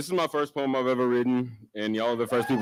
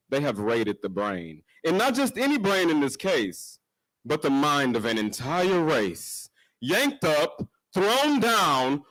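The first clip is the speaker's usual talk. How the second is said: spoken-word poem; 3 semitones higher than usual. Acted. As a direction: recite it as a spoken-word poem, swinging in pitch. Recites own first poem at open mic.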